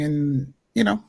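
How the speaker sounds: ordinary talk, nothing marked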